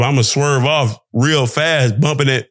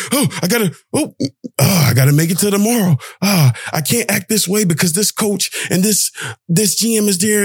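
mock anxious inner voice; 6 semitones higher than usual. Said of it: "such a fixed mindset to say".

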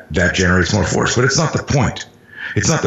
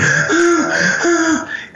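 drawn-out, high sobbing gasps, acted; mock panicky breaths, pitched very high; imitated hyperventilating gasps. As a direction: gasp like a hyperventilating child. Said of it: "like that hyperventilating eight-year-old".

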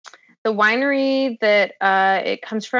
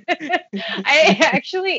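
laughing; 7 semitones higher, much slower than usual. Real, not acted. Laughing after teasing.

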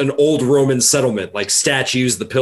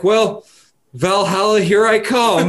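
resigned Viking; 7 semitones higher, 3 dB louder, slower than usual. Retold or imitated. Voicing dying Viking, resigned.

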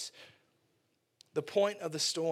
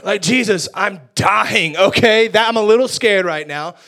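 desperate yell; 2 semitones higher, 17 dB louder than usual. Pretend. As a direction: shout it out while going under. Sinking Peter answers Jesus.

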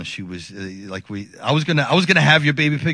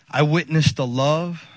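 ordinary talk

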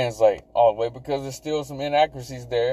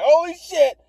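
excited surprise; 12 semitones higher, 3 dB louder than usual. Real, not acted. Excited about a player returning.

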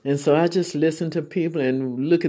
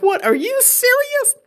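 much higher, squeaky disbelieving question; retelling her own stunned reaction; mock disbelief. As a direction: play up shock at the price. Retold shock at ticket price.

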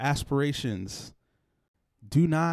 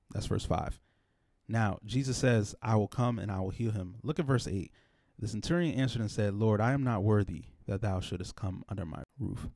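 softer, measured Bible reading; scripture reading; 4 semitones lower, 6 dB quieter than usual. Acted. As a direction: read the verses softly and solemnly. Reads the scripture text.

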